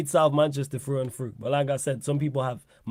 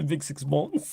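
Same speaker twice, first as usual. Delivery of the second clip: amused put-on French; mock French accent; 4 dB quieter, much slower than usual. Teasing his friend with the name, mock French accent. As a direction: repeat it laughing in a joke French accent. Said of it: "I prefer the French accent".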